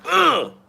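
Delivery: sharp high mock grunt of effort; a quick high grunt as the pencil goes in; stabbing grunt. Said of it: "went like".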